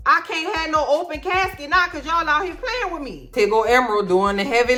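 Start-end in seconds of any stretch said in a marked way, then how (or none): 0.1-3.3 Doug's ghost voice. Acted. Dead husband's ghost refusing to enter the room, high and sassy. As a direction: scoff from the doorway, high and sassy. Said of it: "Doug said".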